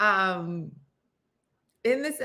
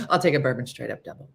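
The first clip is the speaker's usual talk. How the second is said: quick order; 8 semitones lower, much faster than usual. Retold or imitated. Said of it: "I'm like".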